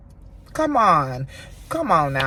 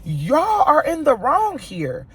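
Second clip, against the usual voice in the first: retold rant at the camera, high-pitched; going off; 8 semitones higher than usual. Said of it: "And I was like".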